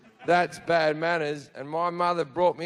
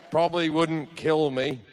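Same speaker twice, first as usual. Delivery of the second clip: ordinary delivery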